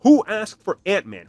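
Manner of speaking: ordinary talk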